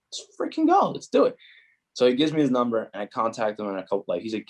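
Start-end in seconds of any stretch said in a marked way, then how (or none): 0.1-1.3 high-pitched excited yes, retold; eager agreement. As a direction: re-enact jumping at the offer, pitched way up. Retells his eager yes to the offer.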